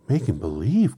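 high, loud, incredulous question; astonished cry. A narrator's reading. Dorothy hearing Oz confess, a loud astonished cry. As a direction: cry it back in disbelief. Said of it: "cried Dorothy".

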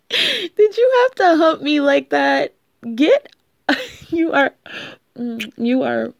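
high, loud laughing disbelief. Real, not acted. Laughing at playful boyfriend.

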